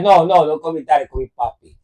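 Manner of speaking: mock Spanish dad; fake Spanish accent. Dad correcting his daughter in a fake Spanish accent. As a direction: imitate a Spanish papi, accent put on. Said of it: "talking with a Spanish accent".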